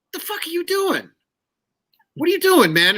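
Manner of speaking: high-pitched imitation of a carping conductor; high mock scolding, falling off at the end; mock exasperated conductor. Imitates conductor stopping to berate him.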